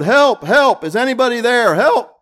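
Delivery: loud, high cries for help; desperate shouts for help; panicked yell. Retold or imitated. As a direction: call out like a man hanging off a cliff. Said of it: "he yelled".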